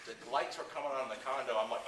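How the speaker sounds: ordinary delivery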